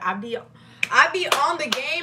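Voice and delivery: ordinary delivery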